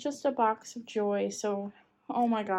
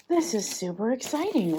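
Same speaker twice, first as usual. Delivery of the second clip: normal talking voice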